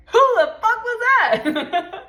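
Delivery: high, loud, slow cry broken by laughter; hammed-up laughing alarm; laughing shock. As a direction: shriek it half-laughing in disbelief. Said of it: "And you're like".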